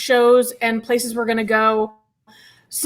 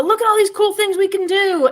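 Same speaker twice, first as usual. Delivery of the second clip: a higher, peppy selling voice; mock sales pitch; 7 semitones higher than usual. Coaxing Adam into the trip, a higher, eager mock pitch. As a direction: pitch the fun to a reluctant traveler.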